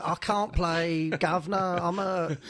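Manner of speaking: a hint of a put-on Chelsea player accent; slow, lightly voiced footballer crying off a game; mock footballer accent. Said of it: "Who were you doing there?".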